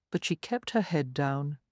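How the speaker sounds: plain reading voice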